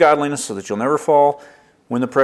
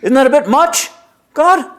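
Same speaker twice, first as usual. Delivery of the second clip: much higher, louder disbelieving cry; incredulous squeak; 13 semitones higher, 6 dB louder than usual. Pretend. You to God, staring at a jet engine, incredulous high cry. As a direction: gasp it out, you can't believe the size.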